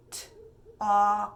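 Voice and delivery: slow letter-by-letter robot sounding; sounded out. Shown as a demonstration. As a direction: pull each sound apart slowly. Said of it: "break it down first".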